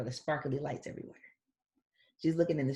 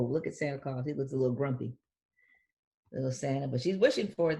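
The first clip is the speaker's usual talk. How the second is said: ordinary delivery, nothing marked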